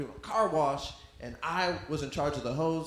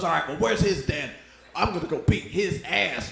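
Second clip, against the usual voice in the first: louder fired-up threat; mock tough dad; 6 dB louder than usual. Dad decides to go fight the other father, louder. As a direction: announce it louder like a riled dad. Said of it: "He goes".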